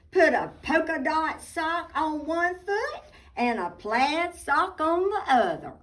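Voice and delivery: plain reading voice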